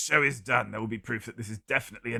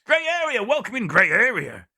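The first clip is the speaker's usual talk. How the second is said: excited greeting; 8 semitones higher, 6 dB louder than usual. Real, not acted. Greeting a chat viewer.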